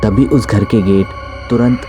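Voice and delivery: ordinary delivery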